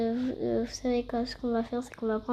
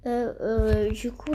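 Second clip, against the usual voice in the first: ordinary delivery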